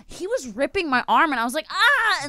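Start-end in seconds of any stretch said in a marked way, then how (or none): 1.7-2.2 high-pitched acted scream; sharp rise into a mock "ah"; retold scream. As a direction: imitate screaming as a dog rips your arm. Retold scream while dog rips arm.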